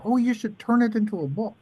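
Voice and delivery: high, light casual offer; tossing it off breezily in her voice; high airy easygoing impression; imitating Ellen.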